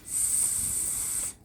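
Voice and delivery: S as a soft unvoiced hiss. Shown as a demonstration. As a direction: hiss the letter S. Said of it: "Two sounds for letter S".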